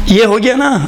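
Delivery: normal talking voice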